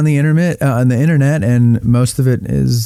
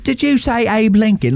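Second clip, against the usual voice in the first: higher mock Birmingham voice; Birmingham accent; 9 semitones higher than usual. Cohost guessing the accent of the film character. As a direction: try it in a Birmingham twang. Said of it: "Like more of a".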